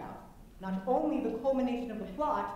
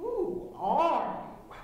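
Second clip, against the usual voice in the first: louder and very drawn out; louder, slowed question; 6 semitones higher, 6 dB louder, much slower than usual. Retold or imitated. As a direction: let the question swell. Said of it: "you think to yourself".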